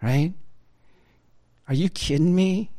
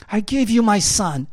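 emphatic; 6 semitones higher, 7 dB louder than usual. Acted. Quoting God, repeated louder.

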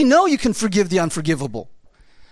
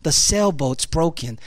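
usual speaking voice

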